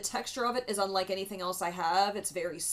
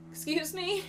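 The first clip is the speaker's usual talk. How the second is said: sharply higher, stunned disbelief; incredulous excuse me; 11 semitones higher than usual. Retold or imitated. Stunned reply to the mechanic about the bent rim. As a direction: ask it like you can't believe your ears.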